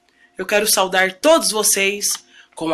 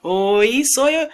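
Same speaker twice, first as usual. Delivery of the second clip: ordinary talk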